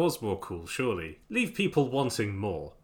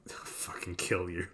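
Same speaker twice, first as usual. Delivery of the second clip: hushed mock threat; breathy whispered joke; whispering; 6 semitones lower, 10 dB quieter than usual.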